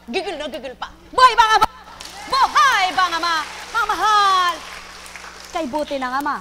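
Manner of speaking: loud, high preacher's call to the faithful; preaching at full force. Real, not acted. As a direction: proclaim it to the whole congregation. Call and response chant. (malakas na pangangaral)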